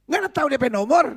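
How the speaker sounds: fast, high questioning as the mother; interrogating mother, acted. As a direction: fire off the question like a pressing mum. Mother interrogates her son.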